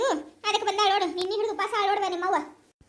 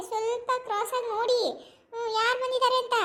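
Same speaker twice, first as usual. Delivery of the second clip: normal talking voice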